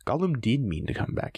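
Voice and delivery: ordinary delivery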